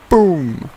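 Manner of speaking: a loud, high mock cannon blast; cannon boom. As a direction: shout the boom like a cannon going off. Cannon fire in read story.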